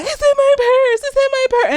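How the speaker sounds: high, repeated mock-frantic plea; imitated panic. Maddie giving up the disc at gunpoint, imitated panic. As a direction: plead it high, imitating someone cornered.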